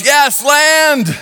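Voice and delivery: high, stretched-out joyful holler; excited yell. Retold or imitated. Man adrift six months sees land, excited yell. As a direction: call it out like a sailor sighting shore. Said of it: "the land and yells".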